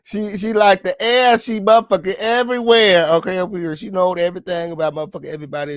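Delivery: high, loud mocking outburst; shrill ranting at her. Real, not acted. Mocking the gossip who is everywhere.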